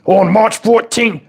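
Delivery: ordinary talk, nothing marked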